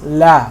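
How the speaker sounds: "la" with a thin a as in "cat"; light l, fronted vowel in "la"; light fatha vowel. Shown as a demonstration. Teacher showing how some letters sound, thin fatha. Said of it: "the sound is gonna be like this".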